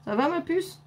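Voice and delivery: high, tender voice to a little one. Real, not acted. Mother speaks to her child.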